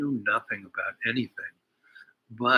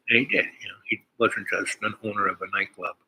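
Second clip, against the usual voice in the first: normal talking voice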